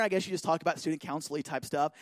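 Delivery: ordinary delivery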